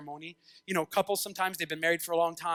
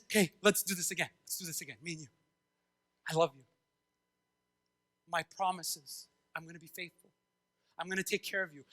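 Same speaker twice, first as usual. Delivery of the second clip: soft, low, intimate promise; tender reassurance; 4 semitones lower, 8 dB quieter than usual. Acted. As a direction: speak gently, as God to the beloved. God speaking love to his people.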